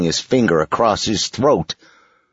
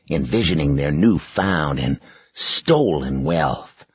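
plain reading voice

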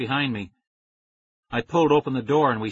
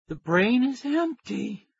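slow and higher, forcing each word out; slow, deliberate; 11 semitones higher, slower than usual. A narrator's reading. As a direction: concentrate on every word. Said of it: "speaking slowly".

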